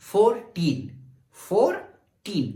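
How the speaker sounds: put-on "fourteen" in two parted beats, teen held; stress on TEEN. Shown as a demonstration. Teacher showing rising energy, "fourteen" with teen stressed. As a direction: split "fourteen" and press on teen. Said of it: "इस पे मेरी energy बढ़ रही है".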